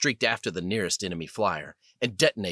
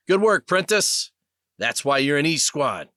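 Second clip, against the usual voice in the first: loud, higher call over the comm; battle praise; 6 semitones higher, 6 dB louder than usual. Acted. Sergeant praising his young pilot mid-dogfight, loud and higher. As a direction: shout the praise over engine noise.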